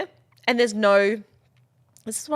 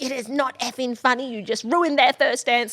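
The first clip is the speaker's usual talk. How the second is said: stern scolding; 5 semitones higher than usual. Retold or imitated. Scolding laughing boss.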